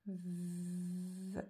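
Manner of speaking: quiet, long buzzing v before the vowel; drawn-out syllable. Shown as a demonstration. Teacher writing out the syllable, drawn out. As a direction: hold the opening v long.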